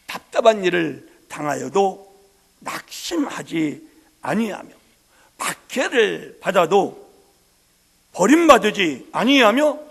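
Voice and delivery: loud, slow preaching swell; full-voiced Korean sermon cadence. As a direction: preach it with rising force. Preaching scripture at full force.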